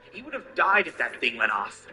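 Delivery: angry. Acted. Angry friend confronting traitor.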